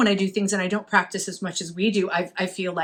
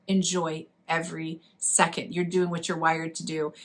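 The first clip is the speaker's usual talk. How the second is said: calm, heartfelt advice; 4 semitones lower, 5 dB quieter than usual. Real, not acted. Slow pep-talk advice to listeners.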